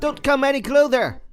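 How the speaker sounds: high-pitched panicky warning; frightened warning. Acted. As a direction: ward them off in alarm. Scared of the snake being brought.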